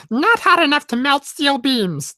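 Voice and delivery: high, slowed comic character voice; Jar Jar. Acted. Co-host answers conspiracy joke as Jar Jar, high impression. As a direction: pitch it up in a silly impression. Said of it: "Did you, Jar Jar?".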